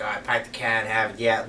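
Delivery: imitated stock Boston accent with dropped R's; mock the go-to Boston practice phrase. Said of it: "preparation for a Boston accent".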